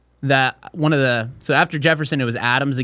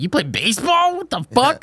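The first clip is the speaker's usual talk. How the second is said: high-pitched mock disbelief; incredulous; 11 semitones higher than usual. Student hearing the Canadian plays baseball, incredulous. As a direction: climb higher into a baffled outburst.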